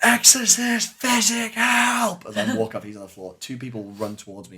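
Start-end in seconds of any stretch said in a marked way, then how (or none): 0.0-2.3 high, loud cry for rescue; retold scream for help. Wounded man on the ground calling for an exorcist, screaming. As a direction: scream it out desperately, much higher. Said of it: "he screamed for an exorcist".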